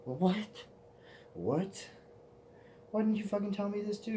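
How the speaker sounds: hammed-up exasperation at your friend; outraged. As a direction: fire off indignant questions. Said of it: "And I'm like".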